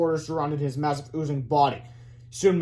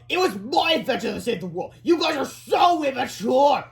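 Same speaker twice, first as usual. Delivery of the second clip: scolding principal; 9 semitones higher, 4 dB louder than usual. A narrator's reading. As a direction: sneer at the kids.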